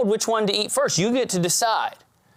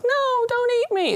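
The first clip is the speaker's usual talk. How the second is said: much higher, tiny pleading frog voice; squeaky little frog. Acted. Cute frog begging the diner not to eat it, high squeaky plea. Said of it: "this little squeaky voice like".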